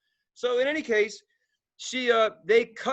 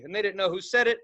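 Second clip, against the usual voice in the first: normal talking voice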